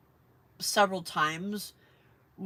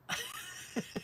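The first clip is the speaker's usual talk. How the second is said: short airy high laugh; a quick laugh with no voice in it; real laugh at her own joke; breathy laugh; 8 dB quieter than usual. Real, not acted. Joke about fleeing a knife.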